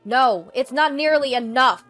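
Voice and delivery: loud, stern laying down of terms; forceful refusal. Acted. Eden sets terms for his sister to stay, stern and loud. Brother rejects offer, lays down terms.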